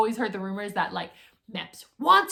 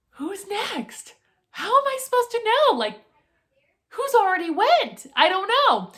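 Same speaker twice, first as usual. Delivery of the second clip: high, rushed flustered voice, pitched up well above normal; flustered panic; 10 semitones higher, 4 dB louder than usual. Retold or imitated. Recruit retelling her MEPS panic, high and flustered. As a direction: replay the moment of being caught off guard, confused and panicky.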